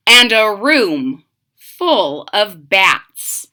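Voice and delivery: ordinary delivery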